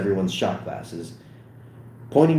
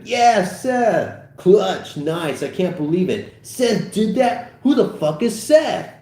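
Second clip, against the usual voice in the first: higher, louder party cheers; crowd cheering; 10 semitones higher, 4 dB louder, slower than usual. Acted. Party crowd cheering Seth's arrival, higher and loud. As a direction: whoop it up for the booze.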